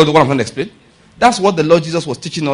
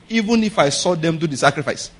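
ordinary delivery

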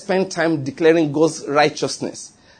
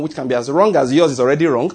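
usual speaking voice

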